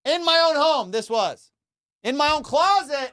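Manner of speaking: high, loud, outraged emphasis; indignant. Real, not acted. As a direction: hammer home the disbelief. Outraged at AirPods in his closet.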